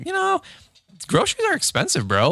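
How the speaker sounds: high-pitched incredulous complaint; mock exasperation. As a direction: whine in mock disbelief about prices. Acts own exasperation at grocery prices.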